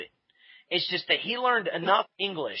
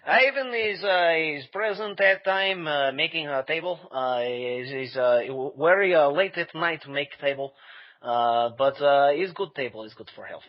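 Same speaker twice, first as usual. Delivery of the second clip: put-on Russian accent Ivan. Host brings Ivan on for the interviewer, in a Russian accent. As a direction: play Ivan in a thick Russian accent, cheerful. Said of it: "You want me to get him?".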